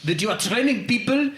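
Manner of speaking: put-on French accent, disbelief building; incredulous French customer. French customer doubting karate disarm training, incredulous accent. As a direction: question it incredulously as a French caller.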